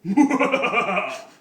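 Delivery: big real laugh breaking out; cracking up at the story; loud burst of laughter. Real, not acted. Storyteller cracking up at own joke, loud laugh.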